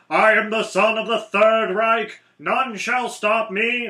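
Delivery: loud hammed-up villain boast, the last words slow; mock Nazi villain. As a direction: bellow it like a cartoon Nazi commander. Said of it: "There was the one guy who's like".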